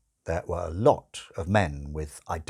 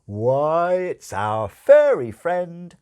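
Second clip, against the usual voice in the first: put-on higher, louder, old-fashioned showman patter; hammed-up stage magician; 5 semitones higher, 6 dB louder than usual. Angel as magician performing for bored children, loud hammy patter. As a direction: ham it up for the children like a music-hall conjurer. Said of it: "his old patter began".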